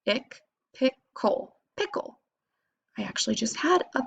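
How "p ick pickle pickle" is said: p, ick, pickle drawn out slowly; slow sounding out. Shown as a demonstration. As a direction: sound it out piece by piece. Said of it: "read your first syllable".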